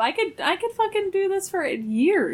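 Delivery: squeaky high insistence; high-pitched protest. Real, not acted. Insisting she's not falling asleep.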